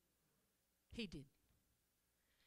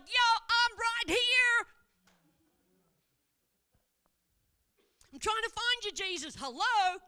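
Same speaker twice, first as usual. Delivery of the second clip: calling back; 10 semitones higher, 14 dB louder than usual. Pretend. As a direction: call back across a distance, Yo. Jesus calls back loudly.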